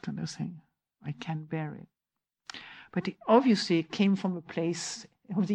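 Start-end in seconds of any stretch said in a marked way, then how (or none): 1.0-1.9 much softer, barely voiced "I can bear it"; hushed inner sentence; very quiet inner voice. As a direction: say it as a tiny inner voice. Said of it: "It's so low key".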